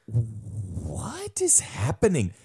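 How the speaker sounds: slow stunned whisper; whispered, baffled. Retold or imitated. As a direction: breathe it out in disbelief. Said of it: "I was like".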